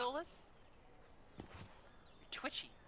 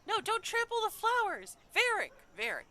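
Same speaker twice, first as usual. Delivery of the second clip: much higher and louder hammed-up yell; mock parent yelling; 11 dB louder than usual. Streamer yells at Varric like a frantic parent. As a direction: shriek at a naughty child.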